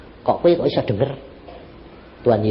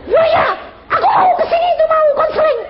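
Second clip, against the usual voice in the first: high, loud, laughing yell of an upset woman; mock angry outburst; 4 dB louder, faster than usual. Pastor mimics angry woman at counseling, high laughing shout. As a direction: imitate her flaring up, half laughing. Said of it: "Dia jadi marah dua kali lipat".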